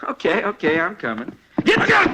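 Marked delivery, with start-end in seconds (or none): 1.6-2.1 high, loud yell of warning; urgent shout. Acted. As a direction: shout it as the danger hits. Sudden attack during arrest.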